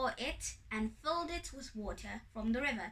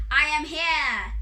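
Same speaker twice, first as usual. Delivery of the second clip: very loud, higher proclamation; loud ringing declaration; triumphant announcement; 4 semitones higher, 11 dB louder than usual. A narrator's reading. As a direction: announce yourself boldly. Anansi announces himself to the god.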